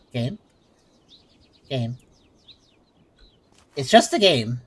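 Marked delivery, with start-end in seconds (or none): 0.1-0.4 rising call, asking; questioning call. Real, not acted. 1.7-2.0 the word Game said lower, falling, flat; flat statement. Real, not acted.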